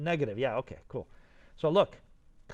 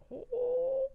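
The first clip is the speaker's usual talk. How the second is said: high, sustained "wooo"; delighted woo. Real, not acted. Instructor marvelling at how sweet the extrude looks, delighted high woo. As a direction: let out a high delighted hoot.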